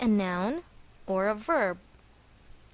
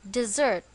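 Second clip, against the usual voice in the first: "desert" with the stress on its second syllable, di-ZERT. Shown as a demonstration. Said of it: "On the second syllable".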